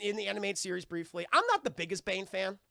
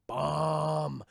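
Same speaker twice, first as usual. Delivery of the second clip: low, louder, drawn-out grunt of a word; Bane's one line; 4 semitones lower, 5 dB louder than usual. Retold or imitated. Quoting Bane's notable line, low booming mock grunt. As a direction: drop low and boom it. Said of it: "his most notable line is".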